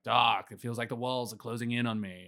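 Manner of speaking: usual speaking voice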